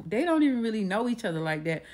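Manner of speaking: ordinary delivery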